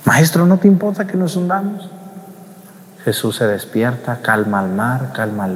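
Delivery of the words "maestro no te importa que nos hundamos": a higher, pleading cry to the master; anxious plea. Retold or imitated. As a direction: plead with him, frightened. Apostles waking Jesus pleading.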